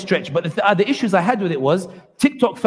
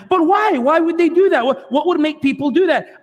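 puzzled newcomer; 8 semitones higher than usual. Retold or imitated. TikTok girl's newcomer puzzled about the attacks, high and baffled. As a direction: imitate someone who knows nothing, asking in disbelief. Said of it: "And I'll try to imitate it here".

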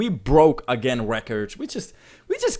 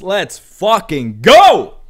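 loud, drawn-out hype yell; hype shout; 2 semitones higher, 11 dB louder, much slower than usual. Real, not acted. Streamer celebrating a record donation with chat, hype shout. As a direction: roar it slowly, stretching the last word.

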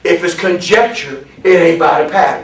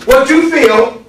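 high, raised preacher's cadence; forceful preaching; 6 semitones higher, 5 dB louder than usual. Real, not acted. Preacher warns the class what to preach, forceful preaching. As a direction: hammer each phrase out to the congregation.

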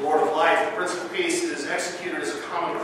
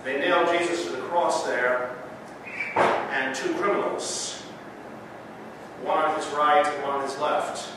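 measured, solemn Gospel reading; scripture reading. Acted. Reads Gospel in service.

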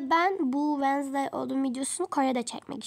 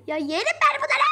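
high, loud, laughing squeal; delighted shriek at cute snacks; gushing; 10 semitones higher, 5 dB louder than usual. Real, not acted. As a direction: squeal over how cute it is.

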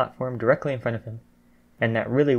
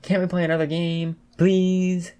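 high, wheedling plea; pleading protest; 7 semitones higher than usual. A narrator's reading. Mina begs for another game, pleading. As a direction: beg with a drawn-out whine. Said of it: "she protested".

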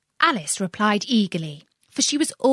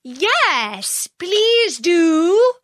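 higher, louder pleading call; chorus calling; 10 semitones higher, 4 dB louder, much slower than usual. Acted. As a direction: join in, begging loudly. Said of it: "the others all joined in chorus".

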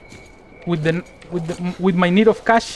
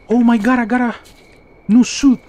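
high, loud thrilled exclamation; excited; 6 semitones higher, 7 dB louder than usual. Real, not acted. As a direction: burst out with delight. Gets new suit in game.

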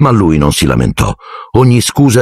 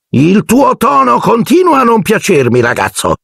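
high angry aunt shout; Aunt Marge shouting. A narrator's reading. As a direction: shout at the boy, pitched very high. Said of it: "profferì".

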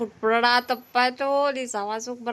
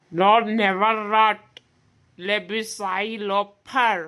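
mock grand English; 3 dB louder, much slower than usual. Mock English stage line.

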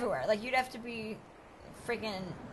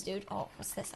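ordinary talk, nothing marked

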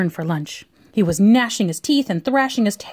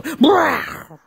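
higher growly roar; monster bellow; 6 semitones higher than usual. A narrator's reading. Snort Snoot's tail is stomped, bellowing roar. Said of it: "the Snort Snoot gave a bellow".

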